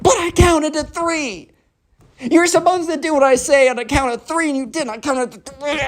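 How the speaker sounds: put-on very high, squealed kid whine; spoiled tantrum. Spoiled kid protests that his countdown failed, high tantrum. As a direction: wail it high like a thwarted brat.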